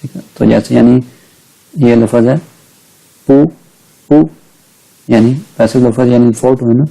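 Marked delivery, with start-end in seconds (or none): 3.3-4.3 पू said with a rising second tone; short, isolated पू lifted upward. Shown as a demonstration. Teacher showing the rule for not, पू in rising second tone. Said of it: "second tone के साथ pronounce करेंगे".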